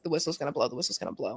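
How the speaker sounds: low, soft repeated thought; hushed inner voice. Retold or imitated. Player near the final whistle, hushed inner thought. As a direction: say it under your breath, sinking further.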